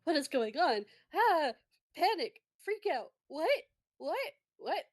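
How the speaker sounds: high, rising panicked what-what-what; hammed-up nervous squeaks. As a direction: freak out, voice climbing. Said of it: "you get very, like, nervous".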